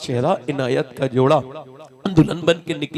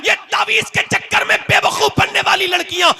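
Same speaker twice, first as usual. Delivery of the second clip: high, loud shouted sermon over music; fiery preaching; 12 dB louder than usual. Acted. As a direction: thunder the message to the sisters. Preaching at full force.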